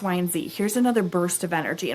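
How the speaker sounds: normal talking voice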